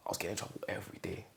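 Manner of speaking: hushed, fast whispered admission. Real, not acted. Admitting trouble at school, quick whisper. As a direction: let it slip out under the breath.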